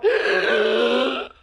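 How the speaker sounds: sharp gasp sitting up; Undertaker gasp. Retold or imitated. Rising from the dead like the Undertaker, sharp gasp. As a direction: gasp back to life like the Undertaker. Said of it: "like the fucking Undertaker going".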